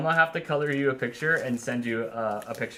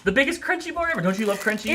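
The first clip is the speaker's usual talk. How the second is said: excited; 7 semitones higher, faster than usual. Real, not acted. Showing off candy bar.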